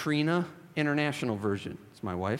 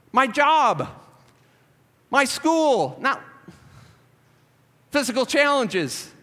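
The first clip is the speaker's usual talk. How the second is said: preaching shout; 9 semitones higher, 6 dB louder, slower than usual. Real, not acted. Preacher listing life areas.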